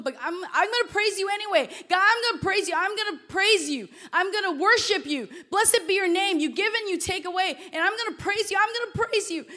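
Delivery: high, loud, insistent prayer; impassioned retold prayer, building in pitch; tearful praise. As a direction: act out praising God through tears. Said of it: "I'm crying".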